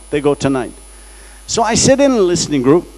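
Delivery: ordinary talk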